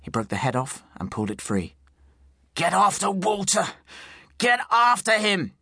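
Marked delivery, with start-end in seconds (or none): 2.5-5.5 high, urgent command; shouted order. A narrator's reading. Wounded sheriff's man orders pursuit, shouting. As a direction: yell it, stretching the repeat.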